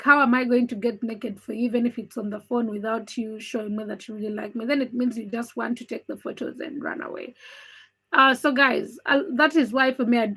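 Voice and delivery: normal talking voice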